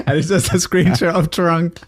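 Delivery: words squeezed out through real laughter; cracking up while telling it; laughing. Real, not acted. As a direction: laugh through the line, pitch up. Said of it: "I was cracking up".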